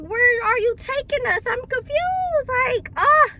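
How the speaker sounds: high, rising panicky whine; acted scared confusion. Passenger scared about where driver goes, hammed-up panic. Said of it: "that was so scary".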